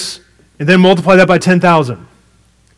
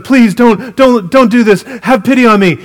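higher and louder, a frantic plea; desperate begging; 7 semitones higher, 5 dB louder than usual. Retold or imitated. Debtor to the servant who grabs him, frantic pleading. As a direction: beg for mercy in a panic.

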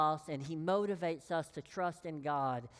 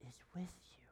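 whispered; 3 semitones lower, 13 dB quieter, much faster than usual. Real, not acted. Preacher whispers reassurance.

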